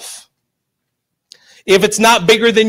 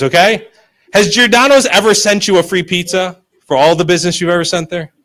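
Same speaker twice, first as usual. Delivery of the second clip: ordinary delivery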